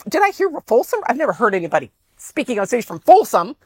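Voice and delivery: high surprised woman's voice; excited woman. Retold or imitated. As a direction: mimic her delighted surprise.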